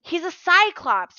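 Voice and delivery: high, urgent warning. Acted. Grover warns Percy about the Cyclops, urgently. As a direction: urge him to be careful. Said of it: "Grover warned".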